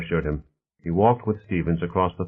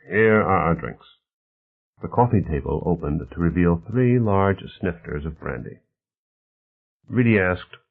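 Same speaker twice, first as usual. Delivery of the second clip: plain reading voice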